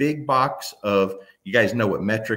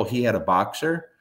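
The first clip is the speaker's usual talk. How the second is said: ordinary delivery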